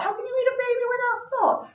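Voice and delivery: high, fast, far-off dragon quip; a hungry dragon grumbling that a baby needs salt first; mock dragon aside. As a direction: toss it off quickly in a silly high voice.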